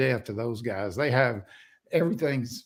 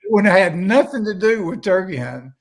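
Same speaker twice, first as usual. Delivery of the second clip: higher, louder punchline of his own retold answer; hammed-up zinger, louder and higher; mock loud retort; 6 semitones higher, 7 dB louder than usual. Telling the seminar guy it would be about the food, mock loud retort.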